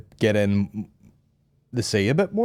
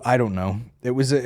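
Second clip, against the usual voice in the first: usual speaking voice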